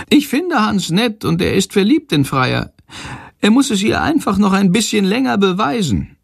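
high, squeaky grumble; grumbling bird voice. A narrator's reading. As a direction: grouse like a cheeky little bird. Shandor the bird speaks.

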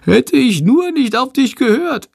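high whiny bat lament; plaintive bat complaint; whining. A narrator's reading. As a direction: moan it like a sulking little bat. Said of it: "jammert Shandor".

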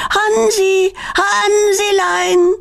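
high, long-held call; drawn-out, high-pitched calling; calling out at the top of the voice. A narrator's reading. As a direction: stretch the name out in a high holler. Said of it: "schreit immer noch aus vollem Hals".

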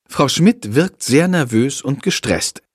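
usual speaking voice

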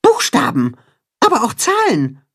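high little mole voice reporting; mole calling out. A narrator's reading. Mole Max reads the sign for Timme, calling. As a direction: call back the news in a squeaky voice. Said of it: "ruft der Maulwurf".

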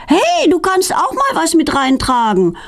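high-pitched call after her; calling after someone. A narrator's reading. Feline to Petronella as she runs off, calling after her. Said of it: "ruft Feline ihr hinterher".

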